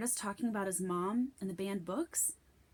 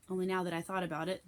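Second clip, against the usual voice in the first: usual speaking voice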